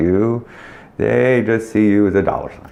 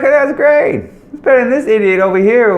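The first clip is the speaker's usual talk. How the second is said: much higher, louder, delighted reaction; mock delighted; 10 semitones higher, 4 dB louder, faster than usual. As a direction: gush like a happy boss hearing about goats. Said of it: "I'd be like".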